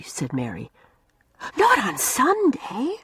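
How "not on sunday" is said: slow, high, scandalised protest; aghast at breaking the Sabbath; shocked. A narrator's reading. Una shocked that Mary would sew on Sunday. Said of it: "said Una, shocked".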